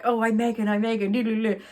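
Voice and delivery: mocking impression with a sing-song jig; Megan. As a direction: tease with a silly mimicking voice. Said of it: "pretending to be Megan and saying".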